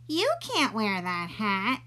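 put-on slow, smug little girl voice; a lightly voiced know-it-all girl; teasing girl. Girl taunts Oliver.

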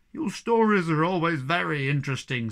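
a touch of bitter sarcasm; mildly bitter; bitterly. A narrator's reading. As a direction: sneer at his stories. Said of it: "said the Hemulin bitterly".

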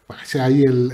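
normal talking voice

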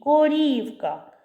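«Корівка» called high and slow, і held; calling out. Shown as a demonstration. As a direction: call ko-REEE-vka, stretching і in «Корівка». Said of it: "Тут наголошений звук". (кличе протяжно, наголос на «і» в слові «Корівка»)

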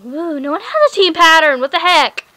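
loud, high burst of protest; worked-up emotional outburst. Real, not acted. Vlogger ranting about team patterns, loud worked-up outburst. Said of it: "sorry, I'm getting kinda, like, emotional".

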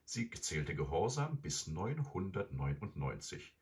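ordinary delivery, nothing marked

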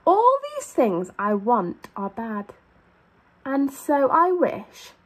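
lively, sing-song storybook read; animated picture-book reading; reading to children. A narrator's reading. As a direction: read it out to a class of little kids.

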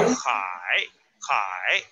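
Shanghai and Hai with hai dipped low; third tone on hai. Shown as a demonstration. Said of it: "ese es acento dos".

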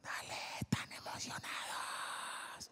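slow whisper of reassurance. Retold or imitated. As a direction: breathe it slowly, calming. (susurro lento y tranquilizador)

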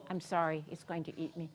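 ordinary talk, nothing marked

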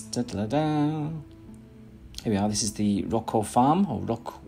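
ordinary talk

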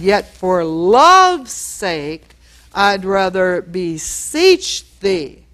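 very slow, tender reading of scripture; gentle plea. Acted. Pastor reading Paul's loving plea aloud, slow and tender. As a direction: linger on each word like a loving request.

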